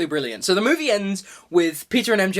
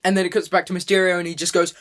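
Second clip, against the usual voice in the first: ordinary delivery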